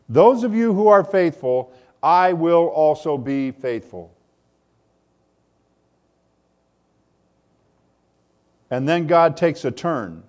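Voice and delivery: loud, high, fervent preaching; preaching at full force. Acted. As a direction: proclaim it like thunder from the pulpit.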